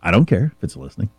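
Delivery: low, quick, offhand shrug of a voice; imitated young people. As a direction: toss it off as indifferent youngsters would. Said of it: "young people say this all the time".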